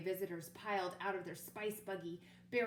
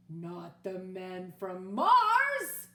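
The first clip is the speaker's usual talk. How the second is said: very slow and much louder; alarmed declaration; 2 semitones lower, 11 dB louder, much slower than usual. Acted. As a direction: drag out the dreaded name in horror. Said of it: "declared Scapito".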